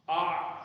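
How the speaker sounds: آ as a long open aa from the throat; aa demo. Shown as a demonstration. Teacher demonstrating the letter sound to students, drawn out. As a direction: sound آ clearly from the throat, as the tutor shows. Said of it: "اس کو پڑھیں گے آ".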